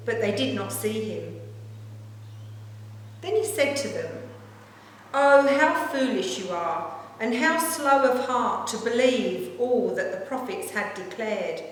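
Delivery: ordinary talk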